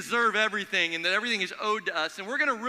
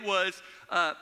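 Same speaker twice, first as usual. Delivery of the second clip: ordinary talk, nothing marked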